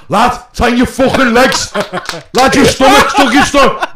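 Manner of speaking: loud, high, drawn-out yell; re-enacted holler over laughter; retold heckling shout. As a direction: bellow coaching at your mate on stage. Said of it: "As I'm shouting, I'm going".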